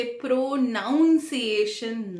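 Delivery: "प्रोनाउंसिएशन" mistakenly said with noun inside; wrong noun sound. Shown as a demonstration. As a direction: show the error: pro-NOUN-ciation in "प्रोनाउंसिएशन". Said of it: "नहीं बोलना है". ("प्रोनाउंसिएशन" में गलती से नाउन बोलें)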